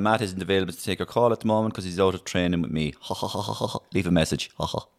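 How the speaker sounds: lightly voiced footballer voicemail impression; deadpan message broken by a little ha-ha giggle; a hint of the footballer taking a message; Roy Keane. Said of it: "will you, as Roy Keane?".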